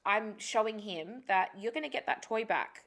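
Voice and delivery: ordinary talk, nothing marked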